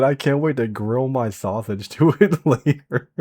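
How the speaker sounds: reading the phrase while cracking up; laughing through it. Real, not acted. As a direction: laugh your way through the line.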